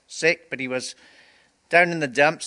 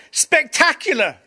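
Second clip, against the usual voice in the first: much higher, louder, thrilled cry of Greg; excited exclamation; 12 semitones higher, 6 dB louder than usual. Retold or imitated. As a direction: burst out with joy. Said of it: "he said".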